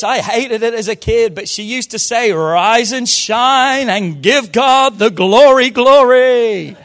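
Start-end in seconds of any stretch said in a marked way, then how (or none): 2.3-6.8 loud, drawn-out chirpy mum impression; mum's wake-up call. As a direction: belt it out slowly like mum waking the house. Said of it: "My mum used to throw open the door and say this".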